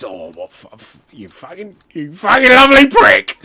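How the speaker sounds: speechless, building to an affectionate roar; hammed-up touched outburst; overcome by gift. Wrestler moved by gift, bursts out.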